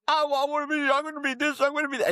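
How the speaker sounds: high, tumbling burst of wishes; excited child. Acted. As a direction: gush like a kid dreaming big. Child's imagination exploding, voiced.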